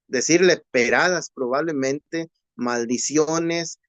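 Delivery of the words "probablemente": usual speaking voice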